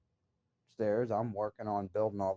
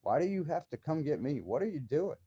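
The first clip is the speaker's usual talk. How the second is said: raised, exasperated demand, pitched well up; retold angry yelling; 6 semitones higher than usual. Recalling yelling at her while she froze, mock furious. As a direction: hurl the questions at her in disbelief. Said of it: "I'm like".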